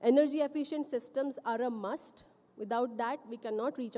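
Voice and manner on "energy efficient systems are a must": ordinary delivery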